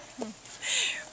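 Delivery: breathy embarrassed chuckle; sheepish laugh. Real, not acted. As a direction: laugh at your own struggle. Laughs after admitting struggle.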